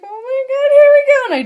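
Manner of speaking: high, loud scream building into the jump; panicked shout. Retold or imitated. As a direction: re-enact a terrified yell as you leap. Re-enacting the moment she jumps.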